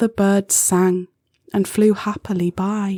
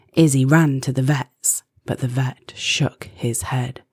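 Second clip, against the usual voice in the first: ordinary delivery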